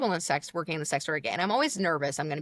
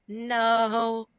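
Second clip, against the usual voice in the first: long, higher drawn-out no; stall, then stretch out a denial; held mock-innocent no; awkward denial; 4 semitones higher than usual. Second denial after long pause.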